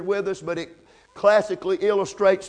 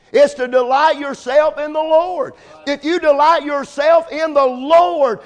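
raised, louder preaching cadence; fervent preaching; 5 semitones higher, 3 dB louder than usual. Acted. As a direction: exhort the young people with fire. Preacher exhorts young people.

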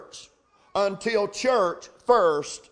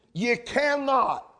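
"cannot" slow and leaned on; emphatic; 2 semitones higher, slower than usual. Shown as a demonstration. Preacher insisting to his church, emphatic stress on "cannot". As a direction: stamp down on "cannot". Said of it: "that's emphatic, dogmatic".

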